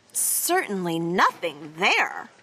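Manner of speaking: low, slow deadpan; deadpan aside, dropping low; understated, slow deadpan; dry joke. A narrator's reading. Dry joke about Tacky's head.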